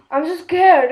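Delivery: loud playful scared cry; mock fright. Real, not acted. Gamer startled by a harmless sheep, mock scared. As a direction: ham up the fright.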